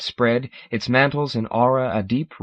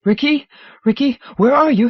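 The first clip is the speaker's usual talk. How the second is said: high loud frantic cries; panicked screaming; 13 semitones higher, 7 dB louder than usual. Acted. Ron calling out for Ricky, high panicked yelling. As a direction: scream for him in terror.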